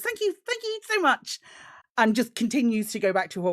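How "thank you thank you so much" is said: gushing thanks. Acted. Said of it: "just says".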